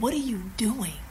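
soft, half-whispered pointed question; hushed challenge. Retold or imitated. Executive challenges the would-be reporter, hushed. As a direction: drop to a near whisper and ask it.

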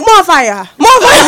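high Jamaican cry breaking into laughter; Jamaican accent. Retold or imitated. Worker hearing it in her head, laughing Jamaican accent. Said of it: "like, the Jamaican accent".

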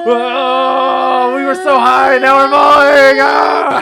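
high, loud panicked shout; panicked yelling. Acted. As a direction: yell as the plane goes down. Plane crashing, panicked yelling.

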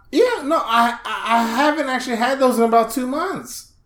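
high-pitched, hesitant patient voice; put-on awkward patient on the phone; fake patient. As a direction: stammer it high to the doctor. Acts patient talking to doctor.

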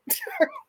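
sudden high laugh; squealing laugh; high burst of laughter. Real, not acted. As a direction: laugh out in disbelief. Laughing at absurd comments.

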